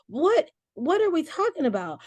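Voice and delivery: high, incredulous outburst; exasperated disbelief. Real, not acted. Mother baffled that her sons should nurse their grandma, exasperated disbelief. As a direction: pitch jumps up in disbelief.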